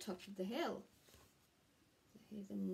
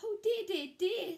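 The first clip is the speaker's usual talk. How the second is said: much higher, louder wailing dismay; dismayed Square; 10 semitones higher, 8 dB louder than usual. Acted. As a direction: moan each dear falling.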